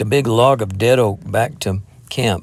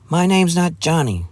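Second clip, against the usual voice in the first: sharp, much higher retort; flashing with spirit; 8 semitones higher than usual. Acted. Jeannie correcting the lad who called her Johnny, spirited flash. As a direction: snap back, offended and proud. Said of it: "she flashed with spirit".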